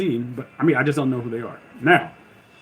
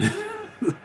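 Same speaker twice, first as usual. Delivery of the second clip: high laugh; 9 semitones higher, 6 dB quieter than usual. Real, not acted. Laughs at own request.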